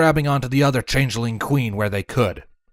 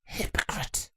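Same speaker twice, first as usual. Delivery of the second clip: snarling; 6 semitones higher, 12 dB quieter than usual. A narrator's reading. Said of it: "Chrysalis snarled".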